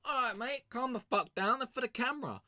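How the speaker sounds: very high, squealing panic; mock frantic reply. Retold reply to guards.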